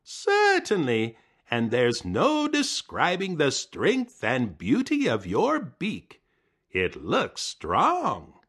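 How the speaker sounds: high, slow fawning voice; slow high sweet talk; flattering. Acted. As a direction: lay the praise on thick. Coyote flatters Sapsucker.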